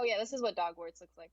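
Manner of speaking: ordinary talk, nothing marked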